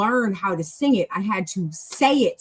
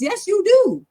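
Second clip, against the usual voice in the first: high, loud, insistent comeback; emphatic retort; 12 semitones higher, 5 dB louder than usual. Acted. Teacher correcting a learner who thinks practice is unneeded, emphatic retort. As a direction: pitch jumps up to insist. Answers the imagined reluctant learner.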